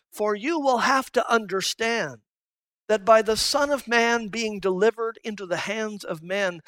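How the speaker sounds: ordinary delivery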